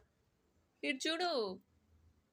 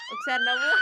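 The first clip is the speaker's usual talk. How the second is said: very high, loud sing-song plea to smile; 10 dB louder than usual. Real, not acted. Parent begging the baby for a smile, in a very high, loud squeal. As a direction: squeal it up to coax a giggle.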